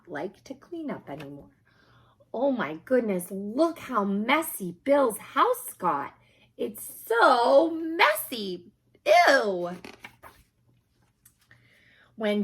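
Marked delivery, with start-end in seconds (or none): 2.3-9.8 drawn-out high disgusted "so messy", then ew. Acted. Reader reacts to Bill's messy house, grossed out. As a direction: wrinkle your nose at the mess.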